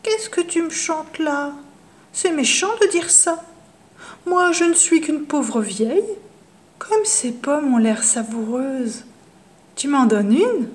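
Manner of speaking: put-on high, sugary old woman's voice; falsely gentle old witch; sweet witch voice. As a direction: coax him like a harmless old granny. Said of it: "d'une voix toute douce".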